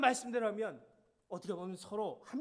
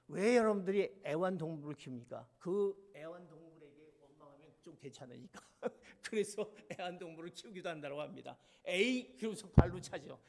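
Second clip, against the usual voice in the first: usual speaking voice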